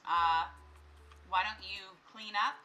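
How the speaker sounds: normal talking voice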